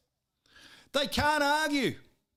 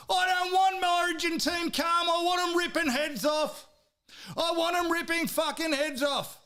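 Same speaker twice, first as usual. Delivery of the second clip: fired-up rant; 6 semitones higher than usual. Real, not acted. Fan demanding a fiercer Origin team, angry shout. Ranting about wanting aggression.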